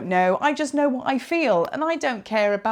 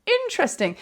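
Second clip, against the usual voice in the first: mock intrigued hmm; 7 semitones higher than usual. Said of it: "unpack things and go".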